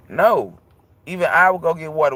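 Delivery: normal talking voice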